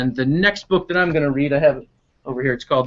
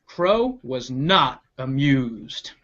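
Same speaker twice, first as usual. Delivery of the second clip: very slow, low ominous read; slow it down for suspense; deadpan storyteller; dramatic narration; 4 semitones lower, 4 dB quieter, much slower than usual. Acted.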